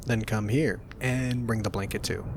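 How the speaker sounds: ordinary talk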